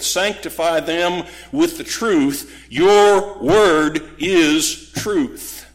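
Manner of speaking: slow, weighty declaration, every word of "Your word is truth" stressed; emphatic quotation. Acted. Quoting Jesus's prayer with force.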